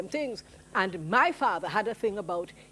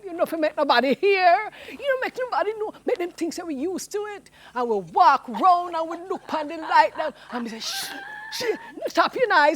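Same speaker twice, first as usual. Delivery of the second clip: lively higher Jamaican storyteller voice, patois; Miss Lou; 5 semitones higher, 5 dB louder than usual. Acted. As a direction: tell it like a beloved old Jamaican auntie. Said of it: "an impersonation of Miss Lou".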